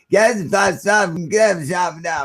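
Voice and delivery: slow, high mumbling mockery. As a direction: mimic a slurred, garbled mumbler, drawn out. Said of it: "you sound like the fucking [name]".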